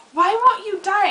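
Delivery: high, loud mock yell of frustration; retold frustration. Fighting a dream dinosaur with a frying pan, a frustrated yell. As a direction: yell it while beating the monster. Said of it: "And I'm like".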